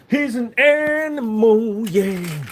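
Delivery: high, very slow goofy voice; silly put-on voice. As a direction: stretch each word out high and slow. Silly put-on voice about dog.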